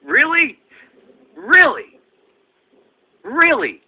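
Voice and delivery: high-pitched, falling disbelief; incredulous. Acted. Comedian reacting to a jealous critic, incredulous. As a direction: throw out each "really" high, in disbelief.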